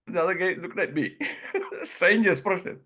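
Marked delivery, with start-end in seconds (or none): none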